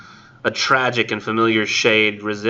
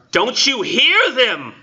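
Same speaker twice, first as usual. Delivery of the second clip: near crying out; 12 semitones higher, 3 dB louder, slower than usual. Acted. Narrator on point of crying out.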